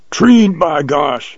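high, drawn-out triumphant call; joyful yell. A narrator's reading. Hunter celebrates the treed lioness, yelling joyfully. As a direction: stretch it out high, jubilant. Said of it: "yelled Jones".

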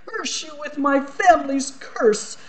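high, theatrical curse of a wounded archduke; Archduke's curse. Acted. Archduke cursing the little girl in a high voice. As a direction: cast the family curse down on her.

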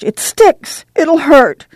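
put-on high, fearful cry of a girl; frightened plea. Scared girl pleading to Ma before the piercing. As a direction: beg in fear before the needle.

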